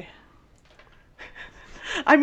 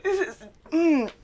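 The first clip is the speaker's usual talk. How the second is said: higher, slow strained hums; mock muffled struggle; 9 semitones higher, 4 dB quieter, slower than usual. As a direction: grunt it out as if gagged. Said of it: "It's like".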